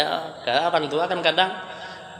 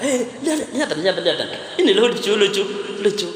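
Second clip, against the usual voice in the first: high, giggly urging to look; excited old man; 5 semitones higher than usual. Retold or imitated. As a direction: eagerly push the phone at them. Old man excitedly shows video. (orang tua bersemangat menyuruh melihat)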